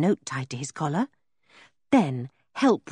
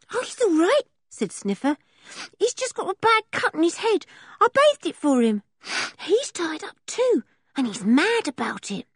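put-on high, matter-of-fact young boy; a scruffy little boy talking plainly; high boyish voice for Sniffer; traveller boy voice; 11 semitones higher than usual.

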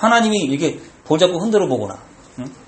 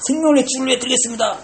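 pious vow; 7 semitones higher, faster than usual. Retold or imitated. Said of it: "생명을 하나님께 드리겠다고 그러는 거야".

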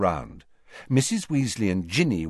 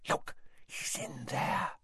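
soft, slow, breathy whisper in his ear; barely a breath, slow; 4 semitones higher, 13 dB quieter than usual. A narrator's reading. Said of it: "she breathed in Harry's ear".

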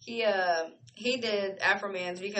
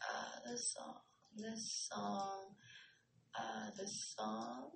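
much softer, slow, drawn out; soft murmur; 15 dB quieter, much slower than usual. Real, not acted. Reacting to the song playing, soft and slow. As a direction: murmur it to yourself while listening.

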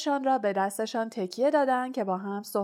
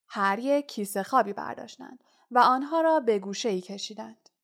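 plain reading voice

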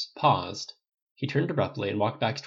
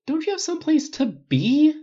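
high, slow, loud complaint; drawn-out fed-up whine; exasperated; 8 dB louder, slower than usual. A narrator's reading. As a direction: push him out with an exasperated question.

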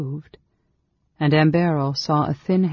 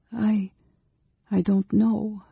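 hesitant, higher, halting; reluctant; 7 semitones higher, slower than usual. A narrator's reading. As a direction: stumble reluctantly over it. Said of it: "answered reluctantly".

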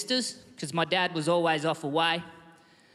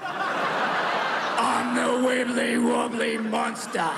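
monster voice building to shout; 4 semitones higher, much slower than usual. Retold or imitated. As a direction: stretch every word then yell the name. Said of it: "said with this voice".

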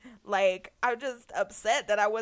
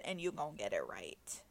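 hushed, breathy whisper of the lyric; whispered quote; 9 semitones lower, 17 dB quieter than usual. Retold or imitated. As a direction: whisper it like the song intro. Quoting the whispered intro.

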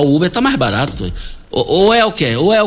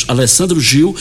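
ordinary delivery

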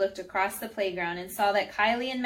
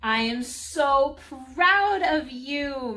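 slow, raised, drawn-out praise; proud praise; 5 semitones higher, 5 dB louder, much slower than usual. A narrator's reading. Mari praising Rooney for praying, slow and proud. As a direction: gush with pride, stretching the words.

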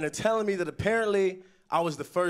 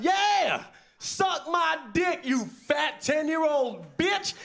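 high-pitched taunting yell, drawn out; yelled trash talk; 9 semitones higher, much slower than usual. Retold or imitated. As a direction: yell it at a kid over the game. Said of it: "I yelled out".

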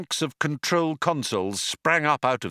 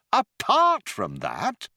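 slow, high, rising apart from that; incredulous; 11 semitones higher, slower than usual. Acted. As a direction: echo it back in disbelief. Arthur repeats in disbelief.

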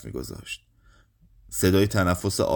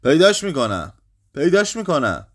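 urgent cry; 6 semitones higher, 5 dB louder than usual. A narrator's reading. Urgent vow during the search.

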